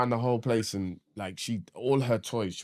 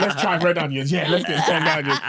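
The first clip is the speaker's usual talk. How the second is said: laughing eagerness; 5 semitones higher, 7 dB louder than usual. Retold or imitated. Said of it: "I was just like".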